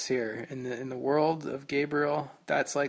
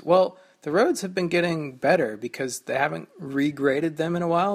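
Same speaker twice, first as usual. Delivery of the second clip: normal talking voice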